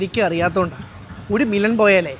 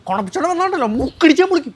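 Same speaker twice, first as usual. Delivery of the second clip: raised, high-pitched warning; angry threat; 6 semitones higher than usual. Acted. Man threatening the woman mid-quarrel, worked up. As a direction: threaten in the heat of a squabble.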